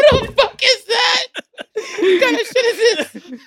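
high, slow, laughing outcry; laughing mock outrage. Friend acts out the class's confusion while laughing. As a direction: mimic the baffled class through laughter.

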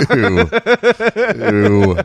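high, loud playful ew; mock disgust. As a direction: squeal it out in pretend disgust. Mock disgust at a cuss word.

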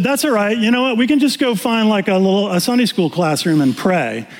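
imitating his wife. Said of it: "So Elizabeth said".

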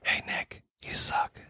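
literal quarterback whisperer, hushed insult; mock secretive coach whisper; Kyle Shanahan whispering. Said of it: "this is my impression of Kyle Shanahan being a quarterback whisperer".

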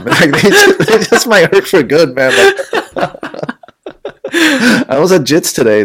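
can't stop laughing about the injury. Real, not acted. Laughing at his own injury.

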